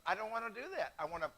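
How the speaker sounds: ordinary talk, nothing marked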